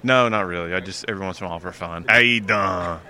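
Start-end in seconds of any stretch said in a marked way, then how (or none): 2.1-3.0 louder, hammed-up Jersey greeting; New Jersey accent. Shown as a demonstration. Driver shows host his once-in-a-while accent, playful New Jersey. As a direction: throw out a Jersey hello for fun. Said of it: "like, the New Jersey".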